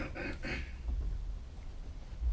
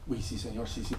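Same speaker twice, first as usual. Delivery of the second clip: much softer sheepish yes; meek submission; 6 semitones lower, 12 dB quieter than usual. Retold or imitated. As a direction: concede quietly like a scolded child. Meek reply to God. (sumiso y avergonzado, en voz baja)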